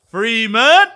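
loud, high, rising shout of the name; excited scream. Real, not acted. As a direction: holler the player's name like a roaring crowd. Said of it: "I might have just screamed".

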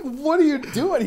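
high-pitched, drawn-out incredulous question; appalled disbelief. Retold or imitated. Student questioning the substitute's slur, appalled disbelief. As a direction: act out aghast disbelief.

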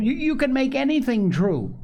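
put-on high-pitched, stammering child's insistence; excited wheedling boy; insistent. A narrator's reading. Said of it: "insisted Joel".

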